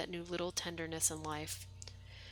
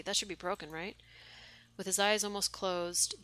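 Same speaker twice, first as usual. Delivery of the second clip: ordinary delivery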